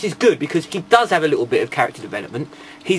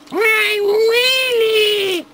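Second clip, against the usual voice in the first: very high, drawn-out squeal; squeaky Wheelie voice; 3 dB louder than usual. Acted. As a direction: squeal it like a little toy robot. Mimics the toy robot Wheelie.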